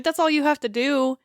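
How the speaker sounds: normal talking voice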